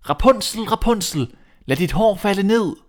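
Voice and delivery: prince calling up. Acted. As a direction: call up to the tower, hopeful. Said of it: "gik han ud til tårnet og råbte".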